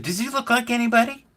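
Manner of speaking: very high, imitated coy question; put-on high voice, baiting; leading question. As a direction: ask it high, fishing for the answer. Imitates grandfather setting up reveal.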